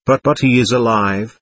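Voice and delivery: plain reading voice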